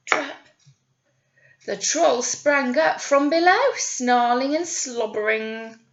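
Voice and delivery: lively storybook reading voice; animated picture-book narrator; story narration. Acted. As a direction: read it out to children with energy.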